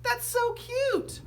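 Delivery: very high, slow, gushing teacher voice; delighted young teacher. A narrator's reading. Young teacher delighted by her class, gushing high. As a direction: coo it like a thrilled new teacher. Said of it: "was delighted".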